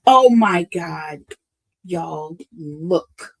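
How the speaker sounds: loud, drawn-out astonished exclamation; real shock. Real, not acted. Reader reacts to a surprise card, stunned. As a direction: stretch it out loudly in real amazement.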